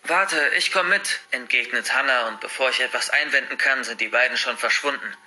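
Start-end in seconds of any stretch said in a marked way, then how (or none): 0.0-1.2 higher, drawn-out call; calling to wait. Acted. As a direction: hold them up to join in. Hannah hurries to join. (zum Warten rufend)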